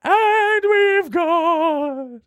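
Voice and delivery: high, very slow ghostly announcement; operatic, drawn-out ghost introduction; wailing ghost. Pretend. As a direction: hold the name in an endless wail. Ghost host introducing last co-host.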